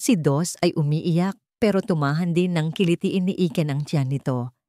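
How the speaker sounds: ordinary delivery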